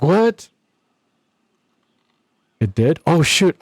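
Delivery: sudden high yelp of surprise; shocked surprise. Real, not acted. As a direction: caught off guard, squeak it out. Told the voice changer was off.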